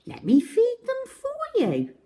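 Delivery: put-on very high chirpy girl; high helpful little voice; little Miss Quick voice. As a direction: squeak it up high and eager. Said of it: "she said".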